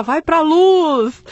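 high, loud, long-drawn mock yell; joking shout. Patient teasing the screaming woman beside her, joking drawn-out shout. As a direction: call it out teasingly, stretching the words. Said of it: "eu vou gritar pra ela".

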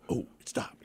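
breathy whispered relief; stoned couple whispering relief; relieved whisper. Retold or imitated. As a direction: whisper like the danger passed.

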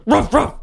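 dog bark ringtone. Retold or imitated.

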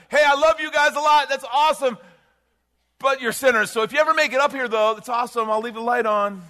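high, loud calling from far above; mock yell from heaven. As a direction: holler it down like a distant, casual God. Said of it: "didn't just yell from heaven".

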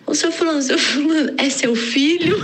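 high, called-out doorman voice; doorman calling out. Retold or imitated. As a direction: call out to the neighbor like a doorman. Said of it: "o porteiro veio atrás e falou assim".

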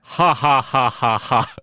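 spaced-out alien villain laugh; measured mock belly laugh, syllable by syllable; Jabba the Hutt. Host as alien crime boss, slow villain laugh. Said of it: "It's Jabba the Hutt".

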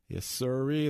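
ordinary delivery

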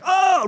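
high, loud "oh" of alarm; hammed-up cry of being exposed; mock shocked cry. Crowd watches sins being written, mock shock.

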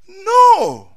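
high, loud sudden NO; emphatic no. Real, not acted. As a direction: cut the thought off with a sharp high cry. Preacher exclaims sharp denial.

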